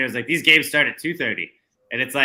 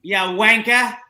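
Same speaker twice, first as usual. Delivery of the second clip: higher, louder British jab; sling it like a pub mate; mock British insult; 9 semitones higher, 6 dB louder, slower than usual. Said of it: "flex your British accent".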